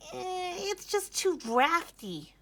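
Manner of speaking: high, slow, crying bunny; very high, slow, tearful whine; whimpering complaint. A narrator's reading. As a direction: whimper it like a shivering little rabbit. Bunny complains about barn.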